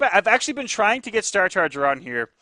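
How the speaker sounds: normal talking voice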